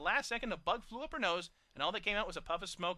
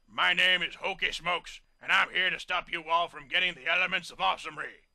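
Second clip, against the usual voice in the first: grumbling big pony; 9 dB louder than usual. A narrator's reading. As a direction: growl your threat like a hulking bully. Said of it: "He scowled at them and grumbled".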